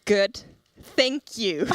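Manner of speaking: "Good," pause, then a drawn-out "thank you"; imitated careful, puzzled reply; hesitant polite reply. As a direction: answer slowly and deliberately, "Good... thank you". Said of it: "And I was like".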